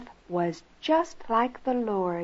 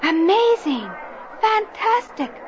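amazed; 8 semitones higher than usual. Acted. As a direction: cry out in wonder. Officials amazed at survivors.

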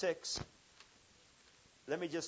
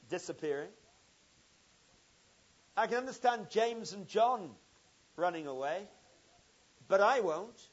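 Peter's eager, louder, higher pledge of loyalty; boastful Peter; 4 semitones higher, 7 dB louder than usual. Acted. Preacher voicing Peter promising Jesus he'll stay, eager and loud. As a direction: play Peter swearing he'll never leave, with big confidence.

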